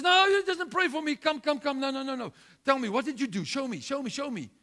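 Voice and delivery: high, loud insistent pleading; urgent demand. Retold or imitated. Healed man urges the couple, loud and high. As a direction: press them hard to show you.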